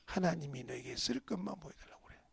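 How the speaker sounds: soft whispered counsel; hushed advice. Retold or imitated. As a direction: say it low, almost a whisper. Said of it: "한 말씀 하신데 뭐라고 하냐".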